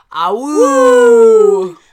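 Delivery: long, high, loud "awoo" howl; werewolf howl. Acted. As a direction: throw back a full wolf howl. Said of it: "And he goes".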